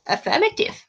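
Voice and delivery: high, robotic "Affirmative"; robot dog voice. Pretend. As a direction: chirp it like a little robot dog. Voicing K nine robot dog.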